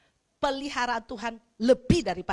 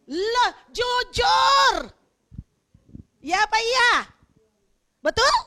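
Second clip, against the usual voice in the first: preaching shout; 10 semitones higher, 9 dB louder, much slower than usual. Real, not acted.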